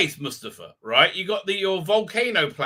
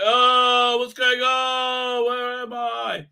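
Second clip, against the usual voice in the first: slow, dazed, higher and louder moans; groggy waking; 8 semitones higher, 4 dB louder, much slower than usual. Retold or imitated. Obi-Wan waking from his burns, dazed and slow. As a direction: drag the words out like you're coming round. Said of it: "Obi-Wan goes like".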